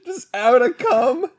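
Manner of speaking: high laughing, near tears. Real, not acted. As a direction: crack up while saying it. Laughing at own joke.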